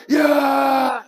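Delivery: high, loud battle yell; war cry. Acted. Soldiers hyping each other up at the battle line, war cry. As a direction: let out a long rallying shout. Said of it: "they shouted a war cry".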